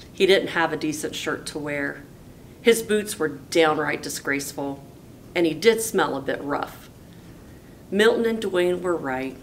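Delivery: plain reading voice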